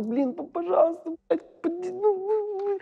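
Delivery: very high-pitched pleading imitation; exaggerated high voice, stammering and begging; put-on squeaky voice. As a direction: plead in a tiny falsetto. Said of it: "Он таким голосом разговаривает".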